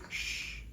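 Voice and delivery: soft breathy shh hiss of a tarantula; imitated spider hiss. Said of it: "she goes like this".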